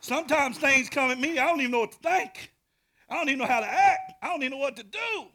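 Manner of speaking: fired-up preacher shout; shouted preaching. Acted. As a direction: rattle it off at full volume. Preaching at full force.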